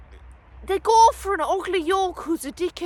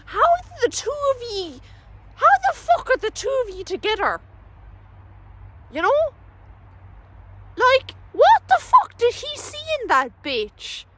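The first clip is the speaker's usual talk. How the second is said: incredulous; 10 semitones higher than usual. Real, not acted. Exasperated disbelief at the couple.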